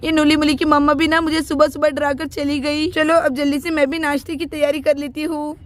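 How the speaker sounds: ordinary delivery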